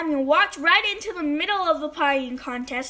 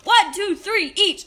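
raised, higher starting count; judge yelling; 5 semitones higher, 6 dB louder than usual. A narrator's reading. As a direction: call the start of a race. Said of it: "He yelled".

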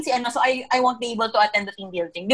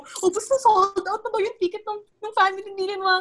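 high-pitched, sobbing plea; teary plea; 8 semitones higher than usual. Retold or imitated. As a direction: whimper the question through tears. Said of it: "Tapos I was crying". (umiiyak na pakiusap)

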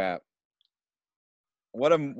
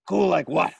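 mock annoyed star; 9 semitones higher, 4 dB louder than usual. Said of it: "He's like".

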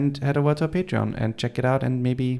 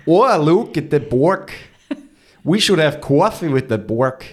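loud, higher Boston with broad vowels; mock Boston accent; 5 semitones higher, 5 dB louder than usual. Co-host riffing on Boston talk, exaggerated Boston accent. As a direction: stretch "coffee" and "bork" in hammed-up Boston. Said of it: "how they would say it in Boston".

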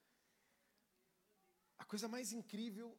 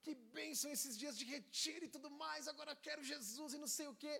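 higher, eager, fast at the end; empolgado; 6 semitones higher than usual. Retold or imitated. Young man gushing excitedly to his pastor. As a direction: rush it out like an excited kid. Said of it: "todo empolgado".

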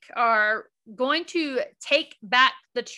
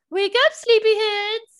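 sing-song wake-up; 10 semitones higher, 7 dB louder than usual. Retold or imitated. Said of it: "they're like".

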